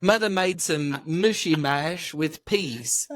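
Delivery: slow put-on British accent with a different voice; drawn-out, playful fake British; mock British accent. Shown as a demonstration. Friend keeps trying a British voice, slow mock British accent. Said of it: "I don't know what you could sound like".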